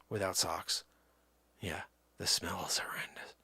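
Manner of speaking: hushed whispered aside; secretive low whisper. Real, not acted. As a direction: whisper it so nobody hears. Whispered aside about smell.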